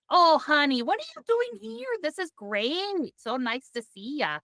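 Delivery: high, hammed-up Midwestern accent; Midwestern character. Nervous friend stuck in character greeting a famous actor, hammed-up Midwestern accent. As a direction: gush a welcome in mock Midwestern. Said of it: "couldn't get out of".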